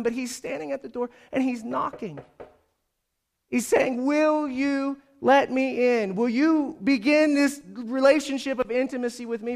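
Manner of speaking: high, emphatic preacher's voice; impassioned preaching. Acted. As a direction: list it out, then press the appeal. Preaching at raised force.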